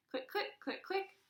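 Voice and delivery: high rapid clicking; camera clicks. Acted. Narrator imitating rapid camera clicks. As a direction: snap like a fast shutter.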